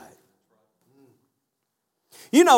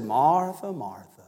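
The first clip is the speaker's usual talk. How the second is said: softer Martha then a faint, falling second Martha; name her gently, no edge at all; very calm; 5 semitones lower, 4 dB quieter, slower than usual. Retold or imitated. Jesus calming Martha, who is letting him have it, very calm. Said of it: "he just very calmly said".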